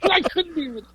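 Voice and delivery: words squeezed out high through real laughter; can barely talk for laughing; laughing hard. Real, not acted. Telling the interviewer the punchline, still laughing hard. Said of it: "I was laughing too hard".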